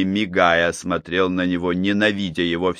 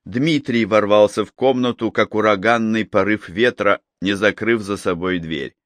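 usual speaking voice

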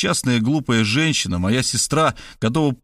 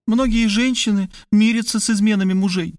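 a higher, lighter woman's voice; Kristina's voice; 10 semitones higher than usual. Acted. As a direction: play the sister, pitched up and urgent.

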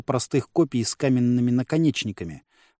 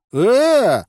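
indignant; 10 semitones higher, 7 dB louder than usual. A narrator's reading. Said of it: "хором возмутились близнецы".

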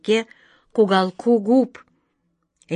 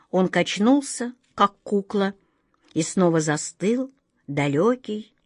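ordinary delivery, nothing marked

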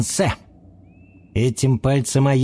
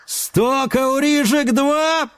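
high, slow drawn-out auction count; auctioneer call; 13 semitones higher, much slower than usual. A narrator's reading. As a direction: ring out the count.

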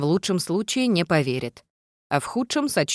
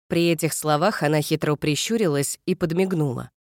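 usual speaking voice

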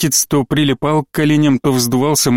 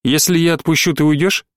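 plain reading voice

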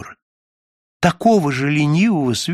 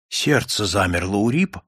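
plain reading voice

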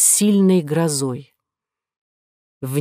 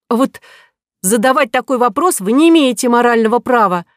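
raised, pointed retort; indignant woman; 6 semitones higher, 5 dB louder than usual. A narrator's reading. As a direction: put him in his place. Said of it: "сказала Нинеля".

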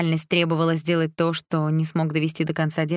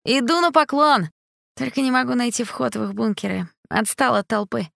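grating voice; 7 semitones higher than usual. A narrator's reading. Stepan's voice continues.

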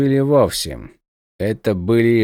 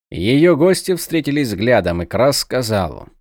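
plain reading voice

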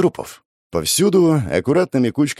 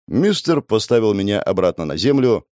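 usual speaking voice

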